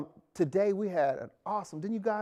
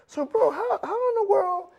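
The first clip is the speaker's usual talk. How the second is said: pitched way up in amazement; asking a friend about his shirt, amazed and high; high excited question; 8 semitones higher than usual. Real, not acted. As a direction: ask like you can't believe it.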